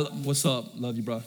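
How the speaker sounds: low, soft, offhand bro mumble; quick low dude-to-dude "love you, bro"; bro style. Shown as a demonstration. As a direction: toss it off like a buddy, casual. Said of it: "bro it up a lot".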